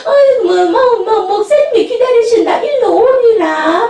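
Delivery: high, loud call across the room; mock summons to the pastor. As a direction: call him over, half laughing. Pastor's wife calling someone over.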